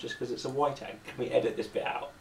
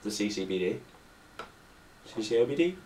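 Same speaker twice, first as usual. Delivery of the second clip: usual speaking voice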